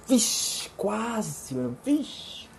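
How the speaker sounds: hushed, high-pitched mock alarm; acting out a close call under the breath; near-miss reaction. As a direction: let out a soft, airy near-miss exclamation. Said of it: "quase aconteceu, eu falo".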